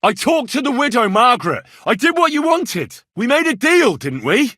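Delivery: high and loud, pressing the point; insistent demand. Acted. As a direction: call in the deal forcefully. Demanding payment, raised voice.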